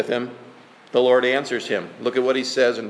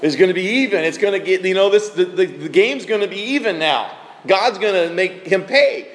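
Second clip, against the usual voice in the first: gleeful; 4 semitones higher than usual. Retold or imitated. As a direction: crow over getting even. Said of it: "Ananias is probably thinking".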